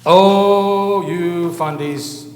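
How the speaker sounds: sing-song mock taunt; mocking secularist jeer. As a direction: jeer like a scoffing opponent, stretching the words. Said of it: "hear the so-called secularists' sabers rattling".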